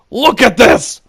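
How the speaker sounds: loud angry insistence; indignant shout. Retold or imitated. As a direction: demand they look. Showing the marks.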